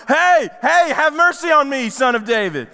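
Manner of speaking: high, loud cry for help; retold yell. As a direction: call out over a crowd. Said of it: "The first time he yells out to him".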